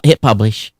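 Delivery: ordinary talk, nothing marked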